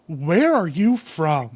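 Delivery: loud, slow imitated New Jersey drawl; New Jersey accent. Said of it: "iconic New Jersey accent".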